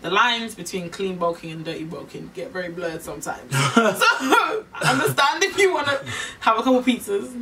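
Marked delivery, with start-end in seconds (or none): 3.7-7.3 laughing. Real, not acted. Laughing about past dirty bulking.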